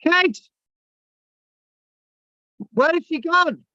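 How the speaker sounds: loud high call for Kate. Real, not acted. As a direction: call out again, searching. Calling out for a caller who vanished.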